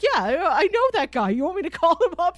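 high, eager Jefferson voice, rushing at the end; quoting Jefferson. Retold or imitated. Fan retelling Jefferson offering to invite Baba O J, high and eager. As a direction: play Jefferson as a high, excited party host, speeding up. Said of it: "Jefferson's like".

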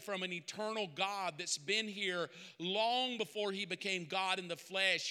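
ordinary delivery

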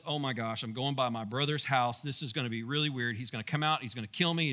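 frightened Jacob. Retold or imitated. Jacob passing his brother's mountain, softly and mildly afraid. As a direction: quietly dread the brother's house. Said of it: "as he realizes".

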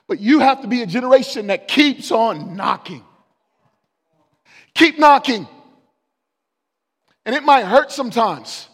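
loud, driving pulpit preaching; full-force preaching. Acted. Preacher urging the young congregation toward an extraordinary life, at full force.

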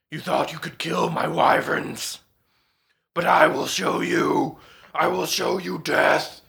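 menacing dragon threat building to a shout; a huge dragon threatening, rising to a roar; booming dragon voice. A narrator's reading. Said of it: "the voice, which has not finished booming".

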